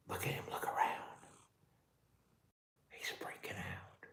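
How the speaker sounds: hushed whisper, slowing at the end. Real, not acted. Telling viewers about his scared pet, whispering. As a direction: whisper so he doesn't spook.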